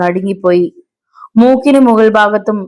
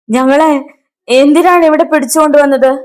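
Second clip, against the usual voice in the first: high, raised indignant yell; roared demand; 7 semitones higher, 4 dB louder than usual. A narrator's reading. Captive demands why they were seized, yelling. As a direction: demand an answer, pitched right up. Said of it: "അലറിക്കൊണ്ട് കായൻ ചോദിച്ചു".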